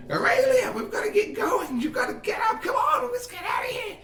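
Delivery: very high, urgent shouted character voice; frantic Meat Shield voice. Acted. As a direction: screech excitedly to rouse a sleeper. Frantic goblin-like figure bursts into room.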